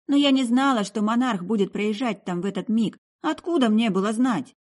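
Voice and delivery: high, aggrieved self-defence; fed-up young woman making excuses; exasperated girl. Acted. Girl defends herself against old accusation.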